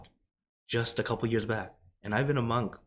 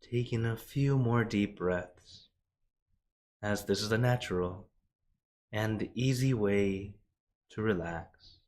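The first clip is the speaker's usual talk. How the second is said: guided meditation; much slower than usual. Acted. Monk guiding the live audience into meditation, slow and soothing. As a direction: ease them into breathing slowly.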